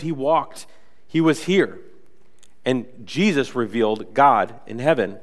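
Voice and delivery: usual speaking voice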